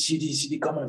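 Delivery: lightly voiced touchline call; assistant coach calling. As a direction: imitate a coach summoning a player. Assistant coach calls him again.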